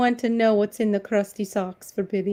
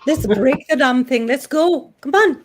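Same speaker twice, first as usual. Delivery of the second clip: mock gleeful urging; 6 dB louder than usual. Urging friends to break the internet, mock gleeful hype. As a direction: hype it up, more each line.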